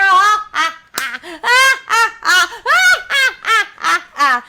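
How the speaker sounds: loud, high gloating cackle; long rolling stagy ha-ha-ha; villain's laugh. Acted. Wolf gloats over two meals together, loud cackle.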